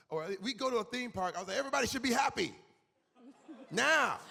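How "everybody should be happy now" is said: louder, higher bossy demand; mock demanding dad. Father at theme park ordering family to be happy, loud mock command.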